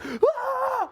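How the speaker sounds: high, loud startled yelp; mock startled scream. Retelling Gino scared out of the shower, high shocked yelp. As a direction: yelp like someone caught in the shower. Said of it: "he was like".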